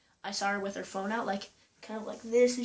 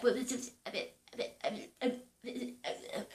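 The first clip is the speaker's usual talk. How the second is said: mock stuttering; 7 dB quieter, faster than usual. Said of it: "she was stuttering".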